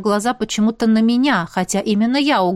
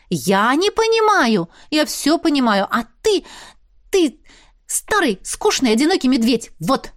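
high, sputtering outrage; huffy sisterly indignation in emphatic bursts; indignant retort; 5 semitones higher than usual. A narrator's reading. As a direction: protest being called little, then fire back name by name. Indignant sister retorts, calls brother names.

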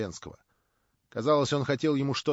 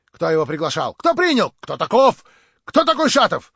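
loud, high, mounting agitation; hail of questions; 9 semitones higher, 9 dB louder than usual. A narrator's reading. Agitated crowd demands who Shatov is, shouting questions. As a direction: pelt the questions out, rising to shouts. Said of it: "сыпались вопросы".

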